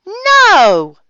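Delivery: put-on a loud, high "No!" from the children; shout. A narrator's reading. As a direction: cry it out together, defiant. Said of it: "shouted the children".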